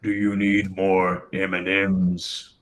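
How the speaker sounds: a lightly voiced costumed villain; smooth menacing host, barely shifted; deep villain voice. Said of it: "you hear a deep voice going".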